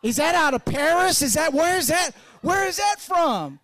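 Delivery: high, loud, wide-eyed amazement; impressed amazement. Retold or imitated. Churchgoers marvel at the pastor's suit, high and amazed. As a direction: gush like you've never heard of it. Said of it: "folks' eyes get real big and they're like".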